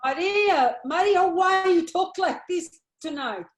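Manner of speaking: a desperate plea to stop this talk; anguished cry. Acted. Mother crying out to her pregnant daughter, anguished.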